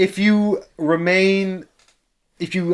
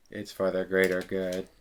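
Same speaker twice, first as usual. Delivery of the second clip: low, hushed solemn chant; low and soft, mock-ominous; solemn echo; 10 semitones lower, 12 dB quieter than usual. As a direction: echo it deep and quiet, like a cult mantra. Echoes the phrase in chorus.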